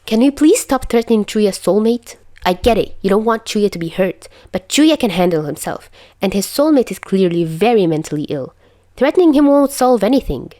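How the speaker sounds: high, loud firm telling-off; firm scolding. A narrator's reading. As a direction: scold them both firmly. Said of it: "she said firmly".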